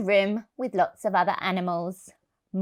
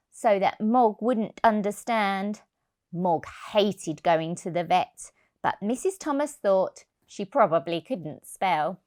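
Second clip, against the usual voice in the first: ordinary delivery, nothing marked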